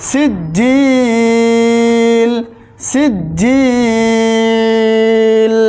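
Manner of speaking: very slow chanted tilawat; Quranic recitation. Shown as a demonstration. Teacher reciting the word for students, drawn-out. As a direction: draw the word out in melodic recitation. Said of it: "তিন আলিফ টান হবে".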